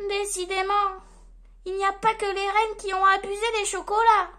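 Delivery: high, loud elf aside; elf thinking. A narrator's reading. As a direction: remark to yourself as an exasperated elf. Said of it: "pense le lutin".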